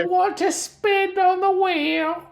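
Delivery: very high, loud cockney squeak; chirpy cockney greeting in a squeaky falsetto; British droid voice. Acted. Droid changed to British for the game, in a high cockney accent. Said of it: "Should he be British?".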